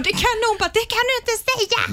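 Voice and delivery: high, mock scolding older sister; playing her shocked sister, high and scolding. Sister telling her off for the lie, high scolding voice.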